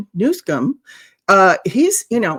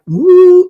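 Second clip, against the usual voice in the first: loud, high imitated "woo" of a startled deer; mock startled "woo"; 10 semitones higher, 12 dB louder than usual. Commentator imitating a cornered mayor, loud mock startled whoop. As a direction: hoot it as a mock freeze-up. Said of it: "like a deer in headlights".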